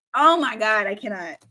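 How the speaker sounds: ordinary talk, nothing marked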